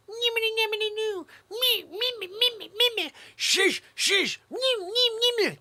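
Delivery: put-on very high, loud childish babble; whiny kids. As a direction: squeal like bratty toddlers. Mimics whining kids.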